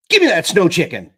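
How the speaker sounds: loud, high demanding yell. A narrator's reading. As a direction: shout it, fed up with the dog. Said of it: "Pip yelled".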